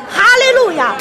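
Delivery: high-pitched rallying cry of praise; triumphant shout. Shown as a demonstration. Preacher leads congregation in praise, triumphant shout. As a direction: call it out with victorious force. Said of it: "要用夸胜的声音".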